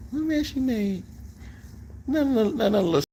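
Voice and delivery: high put-on wife voice; mimicking his wife. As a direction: echo her question in a squeaky mock voice. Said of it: "You heard her?".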